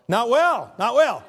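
not well, thrown out loud and high; loud mocking not well. Real, not acted. Preacher scoffs at the excuse to the crowd, loud and mocking. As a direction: call it out with a scoff.

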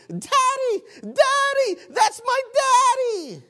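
put-on very high, loud child's cry of daddy; a small daughter calling out to her daddy in the crowd, high and loud; little girl screaming. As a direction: shriek it like a three-year-old spotting her father. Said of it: "she began screaming at the top of her lungs".

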